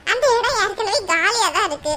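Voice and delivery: ordinary delivery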